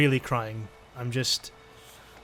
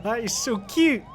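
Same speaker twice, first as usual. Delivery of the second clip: high-pitched gushing delight; cute-overload squeal; 7 semitones higher than usual. Real, not acted. Player admires the baby creature, high gushing squeal. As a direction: squeal it, melting at the cuteness. Gushes after laughing at creature.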